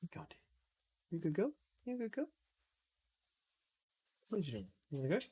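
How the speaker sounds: soft, high cooing to a dog. Real, not acted. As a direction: coo quietly at the puppy.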